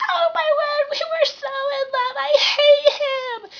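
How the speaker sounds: very high, loud, fake-crying wail; mock sobbing heartbroken girl; hammed-up breakup wail. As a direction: wail it like a dramatic teen breakup. Breakup after six days.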